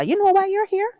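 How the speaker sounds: high, grave nurse voice, imitated; nurse's question. Nurse asks patient why she's here, high imitated voice. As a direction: voice the nurse asking gently but knowingly. Nurse quoted telling HIV result.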